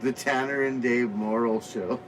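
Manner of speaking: a mock show-title intro, drawn out; slow, low announcement. Shown as a demonstration. Co-host announcing his dream show's title, slow and low.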